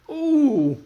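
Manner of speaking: loud, very high wail of alarm; runaway engine wailing as he crashes; wailing ooh. Acted. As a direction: let out a frightened wail. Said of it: "wailed Percy".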